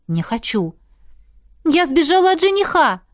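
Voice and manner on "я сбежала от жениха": higher, louder blurt; blurted confession. A narrator's reading. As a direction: spill it out all at once. (выпалить признание)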